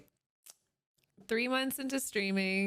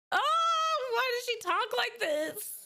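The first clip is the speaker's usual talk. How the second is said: shocked outburst; 12 semitones higher, 3 dB louder than usual. Real, not acted. Shocked at her old voice.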